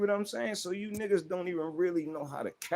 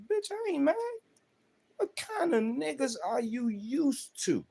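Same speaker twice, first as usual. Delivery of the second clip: high, sharp retort; mock denial; 12 semitones higher than usual. To his women in the restaurant, higher mock protest. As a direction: fire it back up high as if offended. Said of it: "I say".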